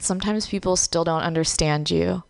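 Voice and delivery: normal talking voice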